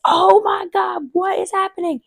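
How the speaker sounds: high, alarmed cry of confusion; panicked in pain. Retold or imitated. Mother-to-be in labour asking herself, high panicked voice. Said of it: "just be like".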